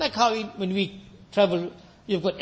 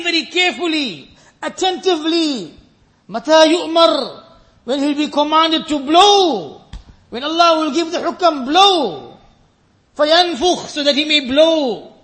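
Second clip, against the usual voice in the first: full-force preaching; 8 semitones higher, 9 dB louder than usual. Acted. Preacher warning the brothers of the trumpet, loud preaching. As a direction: thunder it out to the congregation.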